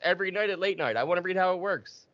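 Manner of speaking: high-pitched mock protest; acted-out upset, pitch held high. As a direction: insist on it like an indignant complainer. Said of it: "and it, and it upset".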